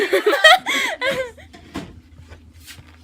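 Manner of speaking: high laugh breaking into tears; high teary laugh. Real, not acted. Reunited friend, laughing high through tears.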